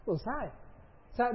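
normal talking voice

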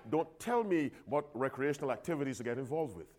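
dropped quiet, a stubborn muttered condition; grudging aside. Retold or imitated. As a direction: tack the catch on softly, under the breath. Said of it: "Here's how some people surrender themselves".

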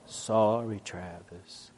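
low, fading sulky mumble; grudging apology. Retold or imitated. Boy forced to apologize, mumbling it away. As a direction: mutter it like a sulky kid. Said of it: "Toby, say you're sorry".

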